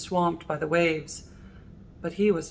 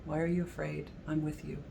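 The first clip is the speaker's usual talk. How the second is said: softer, hushed comfort; tender reassurance; 10 dB quieter than usual. Retold or imitated. Jesus reassuring the congregation, soft tender reassurance. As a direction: soothe frightened loved ones. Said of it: "remember Jesus saying to us".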